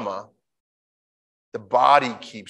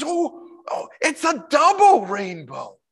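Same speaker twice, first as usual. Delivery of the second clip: higher, breathless mock rapture; imitating ecstatic man; 13 semitones higher than usual. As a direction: lose it over a double rainbow. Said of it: "He goes".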